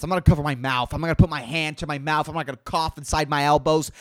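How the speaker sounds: normal talking voice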